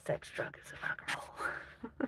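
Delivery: slow, hushed and teasing; playful whisper. Pretend. Streamer joking with the chat about her wild upbringing, in a slow playful whisper. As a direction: whisper it like a naughty motto.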